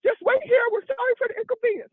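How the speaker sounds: high, fast mock announcement voice; rental clerk. To the narrator at the rental counter, a fast, high mock clerk announcement. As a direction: rattle off the staff warning fast and high.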